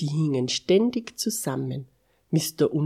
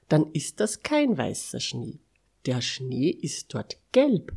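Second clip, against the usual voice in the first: plain reading voice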